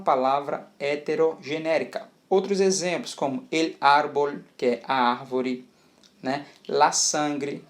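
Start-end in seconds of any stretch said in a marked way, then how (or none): none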